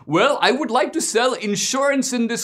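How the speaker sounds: mock Indian accent, high and loud; a polite immigrant pitching himself, hammed-up high voice; Mister Gadde. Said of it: "imaginative rendition".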